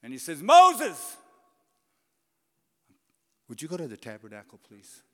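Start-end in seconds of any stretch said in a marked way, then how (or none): 0.4-1.2 loud, high call of the name; booming divine summons; God calling out. Acted. God calls Moses. 3.5-5.0 sudden soft, quick, polite ask; tender divine request; gentle request. Acted. God gently asks Moses.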